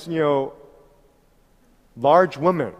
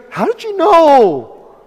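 much higher, louder, slow amazed cry; amazed driver; 11 semitones higher, 9 dB louder than usual. Retold or imitated. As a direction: stretch it out in disbelief. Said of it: "And then he goes".

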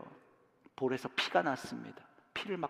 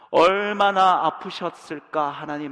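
aching; 10 dB louder, slower than usual. Real, not acted. Emotional climax of sermon.

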